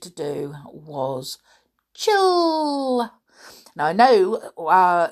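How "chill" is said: high drawn-out chill. Pretend. Drawn-out word about chilling.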